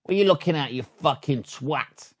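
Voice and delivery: high sharp start, dropping quiet at the end; side window insult. Shown as a demonstration. Driver glaring out the side window, snapped mock insult. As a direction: snap the question, then sneer the insult. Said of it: "And then the side window is".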